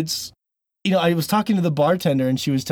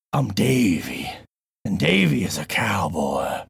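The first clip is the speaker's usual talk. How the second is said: lower, slow gruff cowboy drawl; 5 semitones lower, slower than usual. Shown as a demonstration. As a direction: introduce yourself like a tough ranch hand. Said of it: "See, it's more gruff".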